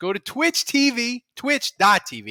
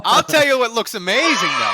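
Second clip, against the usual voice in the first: laughing loudly through the words; 4 semitones higher, 5 dB louder than usual. Real, not acted. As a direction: burst out laughing mid-line. Laughing at bad audio joke.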